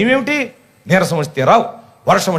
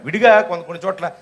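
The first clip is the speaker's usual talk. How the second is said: usual speaking voice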